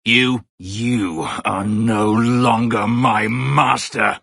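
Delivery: slow, heavy defiance, each word weighed; slow, cold words of a freed slave. Acted. Slave defies his former master.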